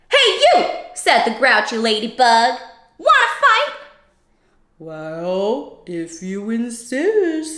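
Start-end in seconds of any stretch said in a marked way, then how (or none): 0.1-0.6 high, squeaky challenge; grouchy ladybug. Acted. 3.0-3.7 high, squeaky challenge; grouchy ladybug. Acted. 4.8-7.6 slow, low, easy reply; slow rhino. Acted. Rhino answers.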